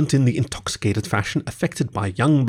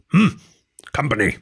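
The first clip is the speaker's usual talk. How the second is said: grunted; 3 semitones higher than usual. A narrator's reading. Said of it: "then grunted".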